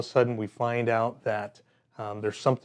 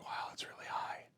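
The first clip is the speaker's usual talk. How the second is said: whispered under the breath, nervous; 19 dB quieter than usual. Retold or imitated. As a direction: breathe it out, scared of the height. Scared at the foot of the tower.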